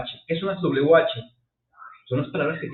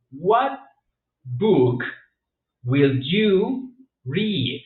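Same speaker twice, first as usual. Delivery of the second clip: slow model question; 3 semitones higher, 5 dB louder, much slower than usual. Shown as a demonstration. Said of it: "Por ejemplo".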